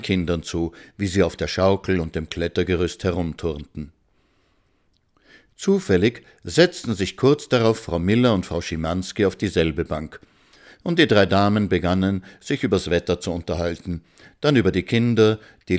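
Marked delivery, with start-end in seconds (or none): none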